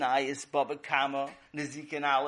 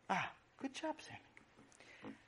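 soft mother praise; 10 semitones higher, 15 dB quieter, much slower than usual. Acted. Mother praises son softly.

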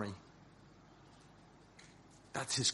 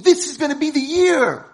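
hopeful dreaming; 12 semitones higher, 10 dB louder than usual. Acted. Dreaming at the window.

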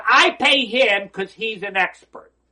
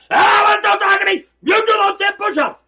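loud, high furious yell; trainer screaming; 12 semitones higher, 9 dB louder than usual. Retold or imitated. Trainer yelling at his whining client, loud and high. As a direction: scream the order down at him.

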